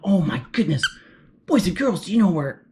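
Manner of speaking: plain reading voice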